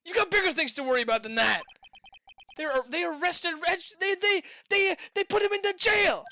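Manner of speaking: loud, rising alarm; panicked. Acted. Assistant bursting in about the arrest, alarmed. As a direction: blurt the news in a rush of panic.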